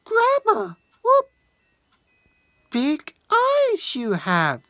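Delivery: put-on girl's voice.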